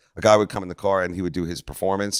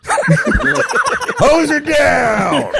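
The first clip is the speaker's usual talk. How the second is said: loud, high shout stretched very long; joking holler; 6 dB louder, much slower than usual. Pretend. Joking about how they wash mom, drawn-out holler. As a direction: holler it out slowly, breaking into laughter.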